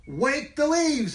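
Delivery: high, slow calling command; stronger command. Acted. Rat leader calling a command to players. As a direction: call the order out bravely.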